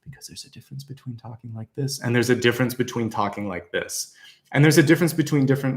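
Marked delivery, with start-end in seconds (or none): 0.0-2.0 soft, breath-only delivery; hushed, hardly any voice behind it; unsupported voice. Shown as a demonstration. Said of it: "taking that solar plexus and pushing it". 2.0-4.1 fuller voice from the solar plexus; supported, fully voiced delivery; pushed from belly. Shown as a demonstration. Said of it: "taking that solar plexus and pushing it".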